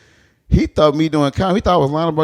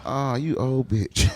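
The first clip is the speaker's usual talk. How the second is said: muttered insult; 7 dB quieter, much slower than usual. Retold or imitated. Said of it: "I'm like".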